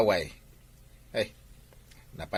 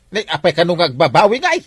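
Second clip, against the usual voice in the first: upset protest; 8 semitones higher, 8 dB louder, faster than usual. Acted. Daughter protests mother backing out.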